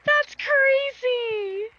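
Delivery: loud, high, drawn-out laughing shout; shouting with laughter. Real, not acted. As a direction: howl it out in disbelief. Streamer reacts to wheel result.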